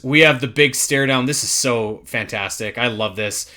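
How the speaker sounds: ordinary delivery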